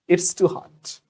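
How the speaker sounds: ordinary talk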